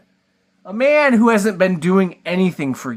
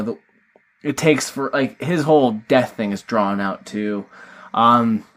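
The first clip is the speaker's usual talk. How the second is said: ordinary talk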